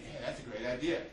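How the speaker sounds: ordinary delivery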